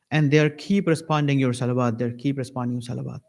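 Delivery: ordinary delivery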